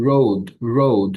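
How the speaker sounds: slow, drawn-out "রোড" with the r sounded; slow model word. Shown as a demonstration. Teacher modelling "রোড" for students, slow and clear.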